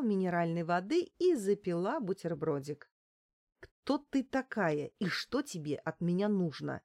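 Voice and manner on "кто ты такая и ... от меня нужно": usual speaking voice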